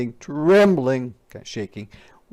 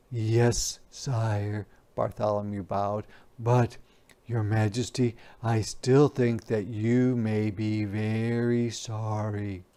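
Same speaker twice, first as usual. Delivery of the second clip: lower, softer timid page voice; page boy; 4 semitones lower, 5 dB quieter than usual. Acted. Page bows to the king, soft and meek. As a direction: answer meekly, a boy who dares little. Bartholomew answers softly.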